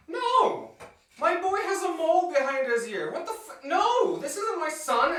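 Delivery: high, loud, desperate shouting as the mother; a mother screaming at officials that this isn't her son, high and loud; frantic mother's scream. Retold or imitated. Mother refuses wrong son.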